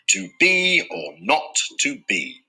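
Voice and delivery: slow, with stress on be, not and be: to BE or NOT to BE; iambic stress. Shown as a demonstration. Teacher demonstrating the iamb on Hamlet's line, stress marked. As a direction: tap out each stressed beat deliberately. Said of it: "the first syllable is unstressed and the second is stressed".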